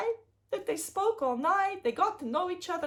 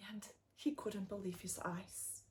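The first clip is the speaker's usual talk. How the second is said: hushed amazed whisper; 8 semitones lower, 14 dB quieter than usual. A narrator's reading. Narrator shows the king's amazement, whispering. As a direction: drop to a soft whisper. King sees the gold.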